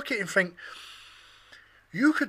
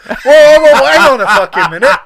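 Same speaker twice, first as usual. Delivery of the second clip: mock outrage shout; 8 semitones higher, 17 dB louder than usual.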